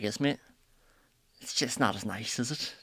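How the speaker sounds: Isn't it in a clipped Belfast accent. Shown as a demonstration. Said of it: "be a bit more like".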